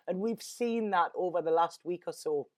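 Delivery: normal talking voice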